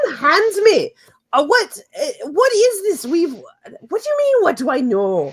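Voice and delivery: high, flustered dwarf voice; indignant dwarf. Acted. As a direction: protest loudly as a manhandled dwarf. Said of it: "She goes".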